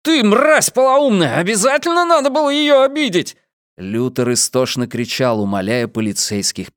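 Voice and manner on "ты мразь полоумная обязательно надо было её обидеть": high-pitched enraged yelling; furious, high interrogation. A narrator's reading. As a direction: rage at him, voice pitched way up. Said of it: "вопил Трэвис".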